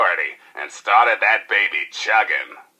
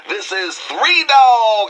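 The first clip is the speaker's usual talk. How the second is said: much higher, louder, drawn-out station ID; hyped DJ sign-off; 12 semitones higher, 4 dB louder, slower than usual. Acted. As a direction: pump up the crowd with your own name. Radio DJ sign-off.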